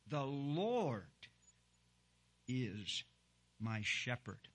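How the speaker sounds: "Lord" held long, then a long pause; stress on Lord. Shown as a demonstration. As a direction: stretch out "Lord" and let it hang before going on. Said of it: "I say that with emphasis on the word Lord".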